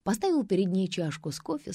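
ordinary delivery, nothing marked

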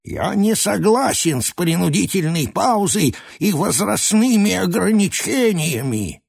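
put-on a grumpy old man protesting; stubborn old man. A narrator's reading. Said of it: "Староживущий".

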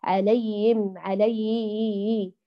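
"عليهم" slow, sliding from ay into im without h; weak, missing h in "عليهم"; faded haa. Shown as a demonstration. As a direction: swallow the h of "عليهم". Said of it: "صوتها تلاشى".

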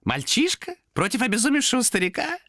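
very high creature voice, ending amused; warning demon. A narrator's reading. Demon warns the man has a knife, gleeful. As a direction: warn him, tipping into a laugh. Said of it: "засмеялся демон".

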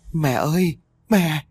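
wailing for mother. Acted. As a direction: cry out to your dead mother, breaking. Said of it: "quỳ xuống mà gào thét vật vã".

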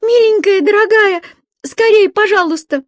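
high, loud tearful begging; trapped girl sobbing and begging the dispatcher; crying plea. Acted. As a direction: plead through sobs to be rescued. Girl begs dispatcher through tears.

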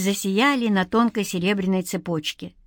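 plain reading voice

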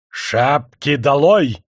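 drawn-out sour order; bilious shout. A narrator's reading. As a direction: shout it at the crowd with bile. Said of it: "жёлчно закричал он толпе".

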